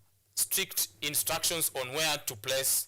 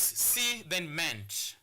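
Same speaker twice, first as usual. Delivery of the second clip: careful teacher's syllable count; much slower than usual. Shown as a demonstration. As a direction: slowly split "C" then "ment", with a gap. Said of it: "has two syllables".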